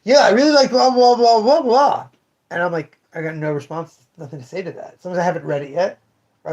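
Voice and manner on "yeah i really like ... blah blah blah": excited rambling. Retold or imitated. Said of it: "And then he goes".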